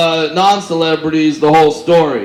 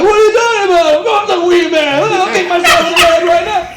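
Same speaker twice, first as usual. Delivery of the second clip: high, loud, frantic mock denial; mock panic; 13 semitones higher, 3 dB louder than usual. Comedian denying to golfers he's not Wee Man, high frantic voice. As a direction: squeal it like a cornered liar. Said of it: "I'm like".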